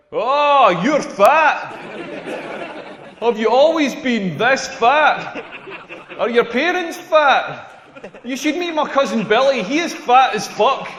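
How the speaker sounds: mock tactless stranger. Stranger walking up to a fat person, brazenly tactless. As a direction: exaggerate the nosy small talk, drawn out.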